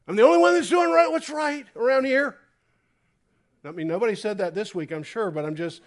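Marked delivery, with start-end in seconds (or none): 0.1-2.4 higher, fast, loud indignant cry; self-righteous shout. Retold or imitated. Preacher mocking a self-righteous believer with a loud high cry. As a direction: blast it like the lone righteous one.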